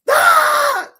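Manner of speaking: sudden high loud cry of dismay; pained outcry. Retold or imitated. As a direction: let out a sharp anguished "Ah!". Recalling being mocked at work.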